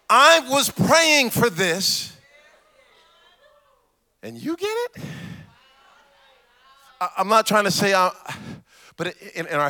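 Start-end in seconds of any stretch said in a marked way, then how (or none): 4.2-5.0 much higher, softer disbelieving question; high soft incredulous rise. Retold or imitated. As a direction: ask it in shocked disbelief.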